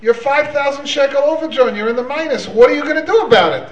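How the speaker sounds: high-pitched, insistent telling-off; mock angry demand. Bank manager confronting the overdrawn customer at his desk, mock angry. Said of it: "pounds on the table and said".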